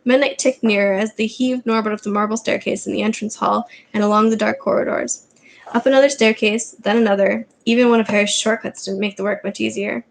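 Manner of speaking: plain reading voice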